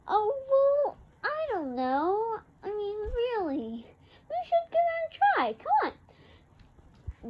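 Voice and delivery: high, bright-eyed eager mom, quick at the end; mom's voice. Retold or imitated. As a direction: coax like an excited mother.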